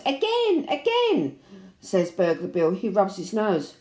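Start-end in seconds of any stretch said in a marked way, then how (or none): 0.0-1.4 put-on baby shouting. Baby shouting at Burglar Bill in delight. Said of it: "The baby laughs and shouts".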